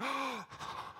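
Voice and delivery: a soft, startled intake of breath; sharp gasp. Acted. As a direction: gasp as if suddenly healed. Woman frozen in the crowd.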